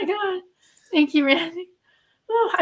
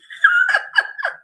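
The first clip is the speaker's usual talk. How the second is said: very high shrieking burst of laughter; real squealing laugh. Real, not acted. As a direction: lose it completely in a squeal. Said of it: "Get it together, [name]".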